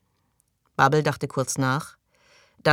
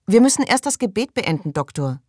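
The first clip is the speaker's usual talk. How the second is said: high, insistent objection; protesting interruption; 6 semitones higher, 5 dB louder than usual. Acted. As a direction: put off the intruder, pitched well up. Interrupts the excited doctor mid-prayer. (mit hoher Stimme einwendend)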